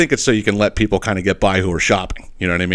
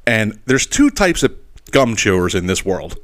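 ordinary talk, nothing marked

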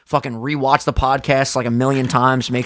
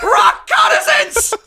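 mock Jim Carrey shout; 6 dB louder, much slower than usual. Quoting Jim Carrey line.

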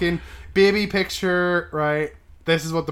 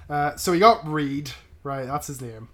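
ordinary delivery

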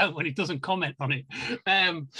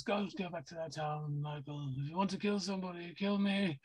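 put-on hushed, intense old British actor voice; soft, urgent Dr. Loomis plea; Donald Pleasance impression; 8 dB quieter than usual. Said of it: "when you were doing your Donald Pleasance".

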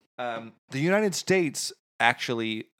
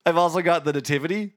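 high, hammed-up Cockney slipping towards Australian; broad comic Cockney, a little Australian; Cockney accent; 7 semitones higher, 3 dB louder than usual. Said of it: "it's turning into a little Australian?".

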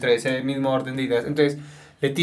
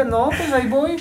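much higher, mock-weary voice; resigned local shrug; 12 semitones higher than usual. Interviewer imitating a resigned neighbor, mock-weary high voice. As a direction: play someone who's given up complaining. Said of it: "Y dice".

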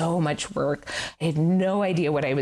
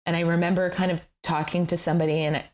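usual speaking voice

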